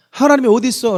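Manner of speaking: loud, high scornful jeer; mocking scoff. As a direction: sneer it like a doubter mocking God. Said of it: "라고 말하는데".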